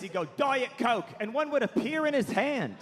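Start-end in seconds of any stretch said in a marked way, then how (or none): 0.4-1.1 higher, curt British rock-star command; exaggerated star's demand. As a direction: summon it with an imperious bark. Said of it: "[name] would go like this".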